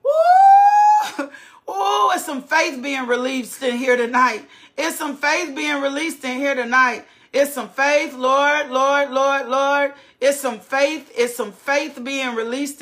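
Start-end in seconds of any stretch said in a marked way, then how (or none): 0.0-1.3 high, loud whoop of joy; excited shout. Real, not acted. Preacher whooping as faith fills the church, ecstatic. 8.1-9.9 louder pleading cries of Lord; fervent calling out. Real, not acted. Preacher calling on the Lord in worship, fervent.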